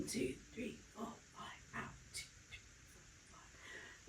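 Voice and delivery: very soft, breath-only counting; whispered count. Shown as a demonstration. Pilates instructor counting the held curl, whispered. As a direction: count on the breath, barely any voice.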